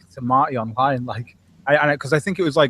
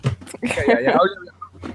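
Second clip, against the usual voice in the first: mock eager yeah; 9 semitones higher than usual. Mock enthusiasm for American freedom.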